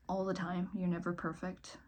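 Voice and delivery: ordinary talk, nothing marked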